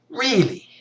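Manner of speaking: exasperated repeated "Really?". Retold or imitated. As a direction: push the second "Really?" up high and hard. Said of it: "looks at her and is like".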